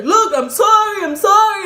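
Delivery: high, loud imitated pleading; acting out a desperate, shouted sorry; frantic apology. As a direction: beg loudly to be spared. Chased man begs her off.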